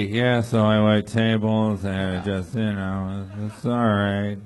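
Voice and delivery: low, slow, bored mumble; mocking his flat voice. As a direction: drone it out like a tired waiter. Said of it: "this is how you sound on your leisurely activity".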